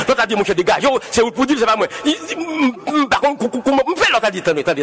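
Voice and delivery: normal talking voice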